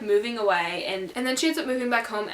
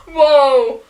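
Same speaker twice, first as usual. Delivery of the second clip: surprised whoa; 4 semitones higher, 13 dB louder than usual. Real, not acted. Reacts to own accent.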